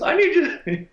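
very high falsetto scolding; piping, hammed-up coach impression; high-pitched old coach. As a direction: chew out the pitcher in a squeaky falsetto. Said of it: "in his high-pitched voice".

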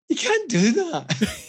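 high, slow, disbelieving; mock horror at the snub; shocked. Teller aghast at his friend snubbing the goalkeeper, high and slow. Narrator's own shocked reaction.

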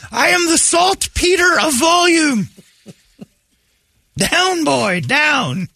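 high, drawn-out hammed-up shout; mock herald. Host proclaiming himself saltpeter of volume, high mock shout. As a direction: holler it slowly like a grand proclamation.

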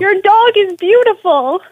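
breezily compliment the dog instead; oblivious host. Retold or imitated. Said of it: "And he said".

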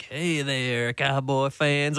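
put-on drawn-out Texas accent greeting; slow Texas drawl, lightly done. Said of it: "in a kind of Texas accent".

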